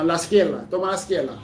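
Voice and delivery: izquierda said as "iskel-da", the R turned into an L; capital-city Dominican R as L. Shown as a demonstration. Demonstrating how capital-city drivers say izquierda with an L. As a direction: swap the R in izquierda for an L, Santo Domingo style. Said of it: "change the R to an L".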